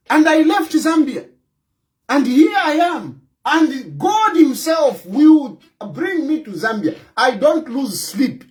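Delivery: usual speaking voice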